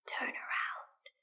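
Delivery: slow, soft eerie whisper from far off; ghostly whisper. Acted. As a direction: breathe it like an unseen thing. Unseen voice whispers command.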